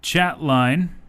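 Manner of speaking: radio announcer read. Acted. Host welcoming listeners to the show, punchy announcer read. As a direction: sell the station like a radio host.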